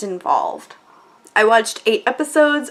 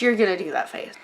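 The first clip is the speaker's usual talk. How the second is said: ordinary talk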